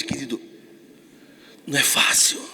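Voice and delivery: hushed whisper. Real, not acted. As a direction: lean in and whisper it. Intimate aside to listener.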